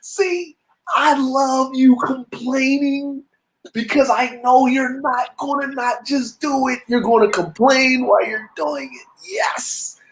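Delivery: high, slow gleeful crowing; petty triumphant cheer; mock celebration. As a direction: gloat over the son's grumbling like a proud dad. Mock-congratulating son on road tax.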